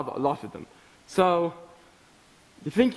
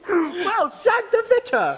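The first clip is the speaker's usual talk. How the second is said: a much higher plummy posh exclamation, laughing; posh swearing; 10 semitones higher than usual. Real, not acted. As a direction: swear like a little toff, delighted. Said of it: "swearing in that posh voice".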